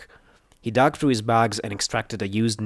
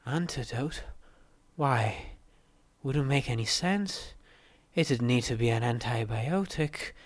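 weak muttering; 3 semitones higher, 8 dB quieter than usual. A narrator's reading. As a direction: mutter faintly, confused and drained. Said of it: "she muttered weakly".

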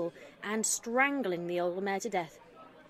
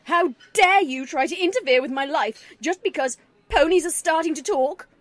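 loud furious shout, much louder; 4 semitones higher, 9 dB louder than usual. A narrator's reading. Daughter shouting at her mother at the table. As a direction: let the anger burst out loud. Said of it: "She was shouting now".

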